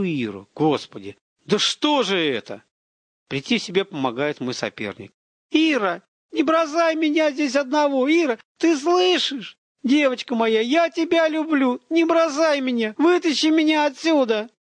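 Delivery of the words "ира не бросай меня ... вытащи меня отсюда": high, loud, drawn-out begging; a man's frantic wail from behind a door; desperate pleading shouts. A narrator's reading. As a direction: beg her not to leave you, screaming.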